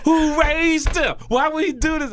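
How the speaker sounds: loud, high, drawn-out outrage; hammed-up high, loud complaint; mock exasperation. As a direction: demand it in comic disbelief. Retells exasperation at friend.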